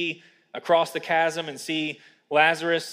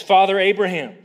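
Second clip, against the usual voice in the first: louder, lightly acted call; crying out; 4 semitones higher, 8 dB louder than usual. Preacher voices rich man calling from Hades, mild crying out. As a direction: call out to a patriarch in appeal. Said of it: "also means to cry out".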